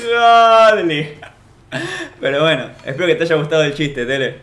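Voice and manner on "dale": high, loud Dale; loud punchline. Acted. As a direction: belt it out as a punchline. Punchline shout. (remate gritado)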